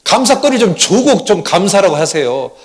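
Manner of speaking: acted loud, high-pitched grumbling; grumbling complaint. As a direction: whine at God like a fed-up wife. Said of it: "하나님께 투정을 했답니다".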